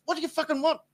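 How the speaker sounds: high, mock-irritated snap; hammed-up impatient demand; imitating Kelly. Kelly answering his tapping, high mock irritation. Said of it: "She's like".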